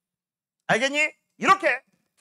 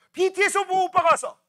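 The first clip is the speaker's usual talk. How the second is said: higher, louder excited fan call; 7 semitones higher, 6 dB louder than usual. Retold or imitated. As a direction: gush over the idols. Fan sees BTS.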